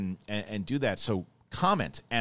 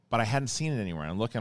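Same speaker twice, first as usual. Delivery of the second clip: ordinary delivery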